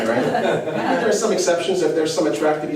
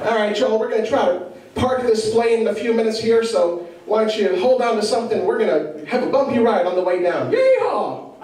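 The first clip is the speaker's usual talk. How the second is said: Larry the Cable Guy; 6 semitones higher than usual. Pretend. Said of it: "piloted by Larry the Cable Guy".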